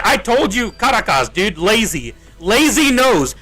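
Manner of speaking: normal talking voice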